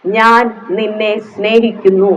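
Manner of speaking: gentle divine voice of love; Holy Spirit speaking. Pretend. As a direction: say it slowly like a loving whisper within. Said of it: "എന്ന് പരിശുദ്ധാത്മാവ് ഉള്ളിൽ ഇരുന്ന് പറയുമ്പോ".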